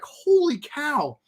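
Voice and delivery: high, drawn-out wonder; retold amazement. Vlogger retelling his reaction to the dev stream, amazed. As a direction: stretch it out, awestruck.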